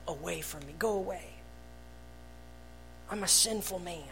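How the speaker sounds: quiet confession. Acted. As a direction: say it softly, head bowed. Our words before holy God.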